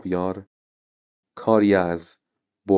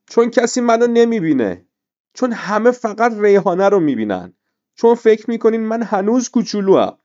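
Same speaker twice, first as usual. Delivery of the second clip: tearful outburst, much higher and louder; bursting into tears; 13 semitones higher, 6 dB louder than usual. A narrator's reading. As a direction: cry it out, hurt and unseen. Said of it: "زد زیر گریه و گفت".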